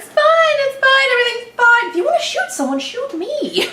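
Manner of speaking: high, frantic insistence everything is fine; hammed-up high-pitched panic; mock panic. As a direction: squeal the reassurances, comically desperate. Acts out panicked character's excuses.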